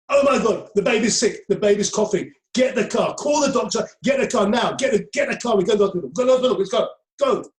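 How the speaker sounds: high, loud, frantic mock panic; hammed-up alarm, rushing to the hospital; panicked wife. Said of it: "usually the wife goes".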